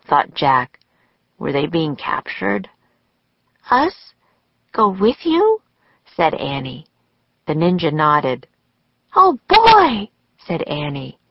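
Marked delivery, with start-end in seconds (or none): 3.7-5.6 put-on high, questioning girl's voice; Annie. A narrator's reading. Annie to the pointing ninjas, high surprised question. 9.1-10.1 loud, very high excited exclamation; Annie. A narrator's reading. Annie thrilled to go with the ninjas, high excited cry.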